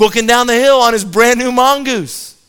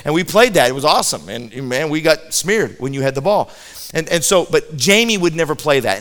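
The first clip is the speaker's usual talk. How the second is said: usual speaking voice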